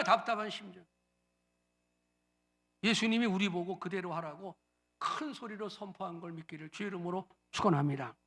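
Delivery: normal talking voice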